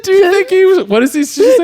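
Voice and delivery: high-pitched hammed-up movie quote; high mock voice. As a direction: squeak out the line like the frightened character. Quoting the movie scene.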